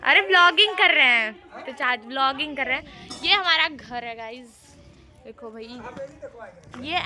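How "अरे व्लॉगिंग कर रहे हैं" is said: raised, higher explanation to the uncle; louder explaining. Real, not acted. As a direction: call it out so the uncle hears. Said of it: "ये क्या है?".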